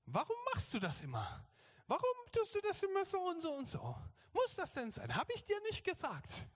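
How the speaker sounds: very high mock scolding child. Daughter scolds Papa as he would, high-pitched nagging. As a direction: imitate a small girl telling off a parent. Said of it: "erhebt ihre Stimme".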